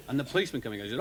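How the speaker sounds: ordinary talk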